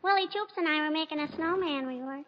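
put-on high, sweet child's voice, sing-song; little girl voice. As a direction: play a cheeky little girl. [name] plays little girl Teeny.

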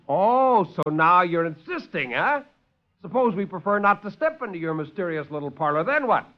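high, mocking challenge; defiant sarcasm. Lieutenant mocks the doctor's insistence, high and sarcastic. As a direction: taunt him, daring him.

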